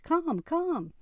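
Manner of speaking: high-pitched eager beckoning; excited, high call to come closer; excited squeal. A narrator's reading. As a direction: squeal it, urging her over. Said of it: "practically squealed".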